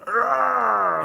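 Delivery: a high, strained mock roar; imitated enraged yell. Imitating the drummer hurling his kit, a high angry yell. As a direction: hurl the drums with a high cry of rage. Tony throwing his drums.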